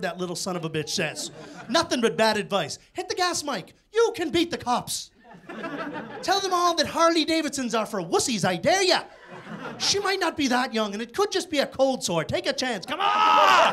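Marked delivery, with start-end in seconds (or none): 2.9-13.7 leprechaun. Retold or imitated. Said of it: "a leprechaun to show up".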